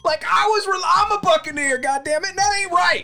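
high, loud indignant squeal; outraged pirate. Pretend. Angry pirate relative voiced.

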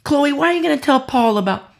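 high, hurried reproachful questions; mock scolding. Church critics reproaching Chloe for reporting sins to Paul, mock indignation. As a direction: play the indignant critic chiding a tattletale.

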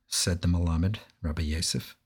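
ordinary delivery, nothing marked

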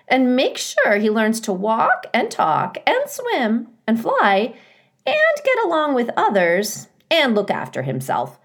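high, loud chattering goose; a goose ticking off easy chores; breezy mother goose. A narrator's reading.

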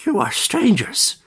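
put-on high, trembling old man's voice; old man, high and eager. A narrator's reading. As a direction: quaver eagerly like an aged elder. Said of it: "addressed them in eager, trembling tones".

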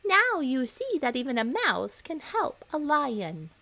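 high, small mouse voice; a tiny creature proving its point; little mouse voice. Acted. The freed mouse reminds the lion, high and small. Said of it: "said the mouse".

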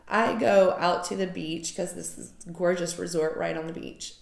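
usual speaking voice

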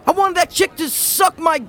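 high shocked outburst; shocked high yell. Acted. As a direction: freak out in disbelief. Intro skit, shocked reaction.

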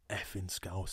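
whispered insult under the breath; hissed insult. Retold or imitated. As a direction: whisper the slur nastily. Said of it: "he went".

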